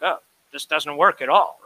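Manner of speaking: loud, fed-up complaint; frustrated outburst. Retold or imitated. Disappointed believer quitting on faith, loud exasperated outburst. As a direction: blurt it out like a let-down believer. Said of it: "They're gonna be like".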